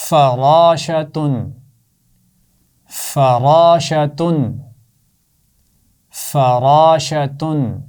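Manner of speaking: فراشتن spelled out very slowly, each sound drawn out; slow clear reading. Shown as a demonstration. Teacher reading a word slowly for children to hear the sounds. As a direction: read it syllable by syllable, slowly. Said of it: "میں اس کو پڑھتا ہوں،".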